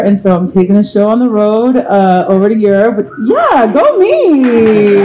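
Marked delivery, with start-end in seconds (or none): 3.2-5.0 high, playful cheer for yourself; yay-me whoop, pitched up; self-cheer. Real, not acted. Cheers herself after good news.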